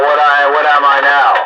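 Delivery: usual speaking voice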